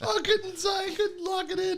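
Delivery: very high British voice slipping toward Australian; mangled British-Australian accent; would-be British accent. Acted. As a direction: squeal it in a botched British accent. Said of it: "That was kind of Australian".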